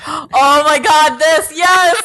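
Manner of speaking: loud, eager outburst; thrilled exclamation. Real, not acted. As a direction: gasp and exclaim with real excitement. Excited about the final episode.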